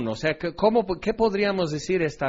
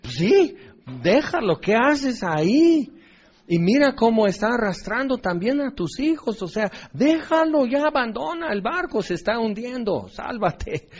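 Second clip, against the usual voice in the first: urgent advice; 6 semitones higher than usual. Retold or imitated. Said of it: "todos le dábamos la razón".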